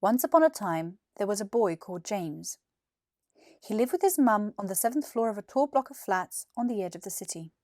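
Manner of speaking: plain reading voice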